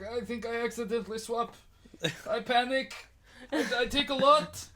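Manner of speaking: high, loud, rising confession; panicking stoner. Acted. Smoker confessing to his mate, high and loud acted panic. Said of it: "And he's like".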